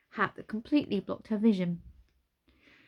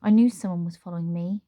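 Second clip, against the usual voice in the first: growled; 7 dB louder, faster than usual. Acted. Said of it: "Nine growled".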